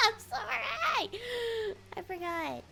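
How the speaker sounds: high laugh into a slow, drawn-out sorry; laughing apology. Real, not acted. Streamer sorry for scaring viewers, laughing apology. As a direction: apologize while cracking up.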